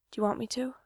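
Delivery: hushed question; whispered. A narrator's reading. Gerard asking Mikey quietly, whispered question. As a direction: ask it softly under the breath. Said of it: "he'd whispered".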